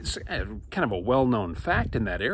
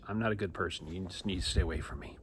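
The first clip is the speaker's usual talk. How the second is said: much softer, low confession; humbled quiet; 5 semitones lower, 10 dB quieter than usual. Acted. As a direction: say it ashamed and small.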